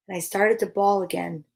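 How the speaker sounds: plain reading voice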